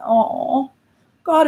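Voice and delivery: ordinary talk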